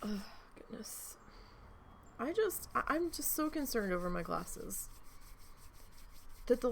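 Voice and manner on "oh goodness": whisper. Real, not acted. Reacting alone to a mistake.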